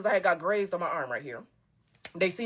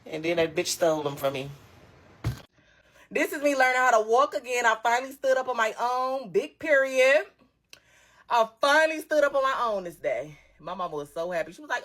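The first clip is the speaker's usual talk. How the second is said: usual speaking voice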